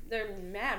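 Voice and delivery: ordinary talk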